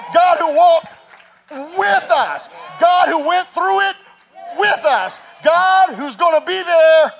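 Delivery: high, loud, rising preacher cadence, each line hammered; full-force preaching. Acted. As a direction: build to a shout from the pulpit.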